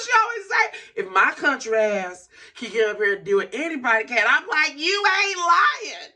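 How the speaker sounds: high, loud, breaking into laughter; laugh-crying. Real, not acted. Agreeing with the singer's saying, shouting through laughter. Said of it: "as I cry, as I laugh".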